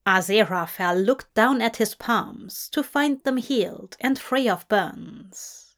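plain reading voice

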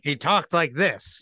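hint of an old-timey movie cop; a touch of Miami Vice cop. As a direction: lightly imitate a TV cop's way of talking. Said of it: "he talked like a, a cop in a movie".

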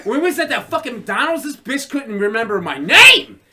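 loud, high-pitched yell to the crowd; shouted stage rant. Acted. Comic telling Joe onstage about the McDonald's, shouted rant. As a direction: holler it like an outraged anecdote on stage.

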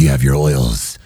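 low monster-hunter impression; Geralt. Co-host joining the Geralt bit, low impression. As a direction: drop your pitch to play Geralt. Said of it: "like Geralt".

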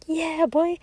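mock drunken bro cheer; rowdy cheer. Drunk guys cheer at nineties tracks, hammed-up whoop. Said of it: "they were all like".

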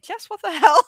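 high, loud, appalled coworker; shocked coworker. Retold or imitated. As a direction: coworker caught off guard. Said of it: "be like".

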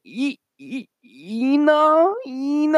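very high, strained stutter into a cry; mock little girl forcing out a name; imitating struggling child. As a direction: squeak out the broken syllables, then call the name.

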